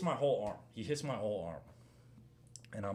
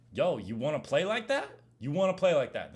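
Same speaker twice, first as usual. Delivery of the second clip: raised, confrontational challenge; heated, louder retort to a rough opponent; retold angry challenge; 3 semitones higher, 6 dB louder than usual. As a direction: throw it out like squaring up on court. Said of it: "And I got really mad".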